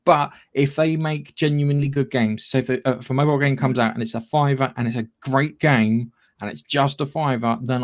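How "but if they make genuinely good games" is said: normal talking voice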